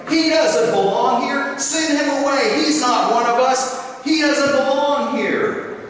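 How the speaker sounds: loud, high chant of rejection; yelling mob. Acted. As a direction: yell him out as a crowd. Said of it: "other savages yelling".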